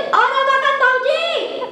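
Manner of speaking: high, slow, drawn-out holler; insistent calling. Acted. Woman calling deaf brother-in-law, long singsong holler. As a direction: stretch the name to reach someone not listening.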